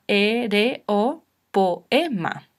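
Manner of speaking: slow, every vowel of "e, re, o, poema" in its own beat; syllable by syllable. Shown as a demonstration. Teacher showing learners how syllables separate, syllable by syllable. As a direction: split "poema" as po-e-ma, with gaps. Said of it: "separaríamos en sílabas".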